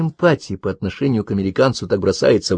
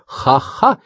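high, rising spoken fake laugh; mocking ha-ha; 6 semitones higher than usual. A narrator's reading. Miyuki mocking Karen's claim of only colleagues, sceptical ha-ha. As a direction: answer with a disbelieving, high ha-ha. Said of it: "она услышала заключительное «ха-ха»".

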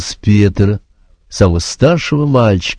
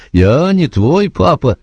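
raised, flustered insistence; higher flustered repeat; 8 semitones higher, slower than usual. A narrator's reading. The sergeant repeating himself to Joe, higher and helpless. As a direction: say it again, higher and more helpless. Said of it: "растерялся сержант".

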